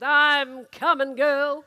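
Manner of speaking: loud urgent call in a changed voice; eager call. Acted. Sandgroper racing to pregnant girlfriend, loud eager call. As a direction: shout it ahead to her. Driver rushing to his girlfriend.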